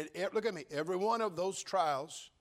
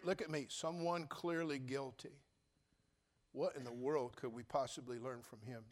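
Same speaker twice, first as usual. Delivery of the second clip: softer, lower skeptical voice; doubting churchgoer; 3 semitones lower, 7 dB quieter than usual. Pretend. Churchgoer questioning Pastor Johnson, softer and lower. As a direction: voice the listener's objection, soft and low. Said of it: "You say".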